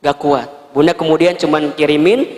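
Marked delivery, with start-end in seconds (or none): none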